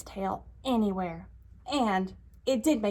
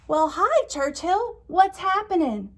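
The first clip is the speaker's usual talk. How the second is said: bright, higher friendly elephant greeting; cheery elephant voice; 9 semitones higher, 5 dB louder than usual. Acted. Elephant greeting his pig friend, cheery and high. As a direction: call out a happy hello as the elephant.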